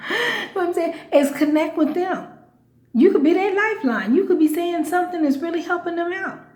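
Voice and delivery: rally them like a preacher. Real, not acted. Urging followers to minister, in a light preacher voice. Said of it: "Get like a preacher now".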